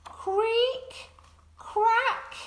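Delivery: high creaking crack; cracking egg. Acted. Eggs hatching, high creak and crack. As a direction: make the shell creak high.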